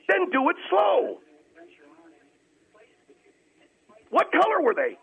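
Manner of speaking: very high, loud, furious demand; shouted order. Real, not acted. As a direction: yell at him to obey.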